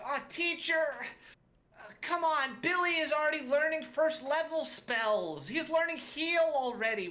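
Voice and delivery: high, loud whiny halfling pupil; groaning student. Acted. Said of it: "groan and go".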